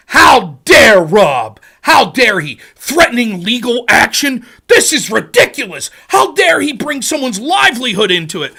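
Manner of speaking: loud, hammed-up indignation; mock outrage. Streamer mimicking suddenly outraged DGG fans, mock outrage. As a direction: act scandalized on the fans' behalf, punching the words. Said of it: "all the D G G people".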